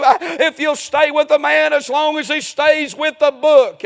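loud, driving revival preaching; full-force preaching. Acted. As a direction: preach it hard to the congregation.

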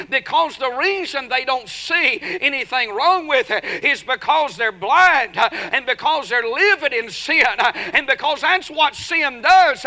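loud, high, driving sermon cadence; fiery preaching. Real, not acted. Preacher exhorting the church about sin and chastening, full-force preaching. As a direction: preach it hard to the congregation.

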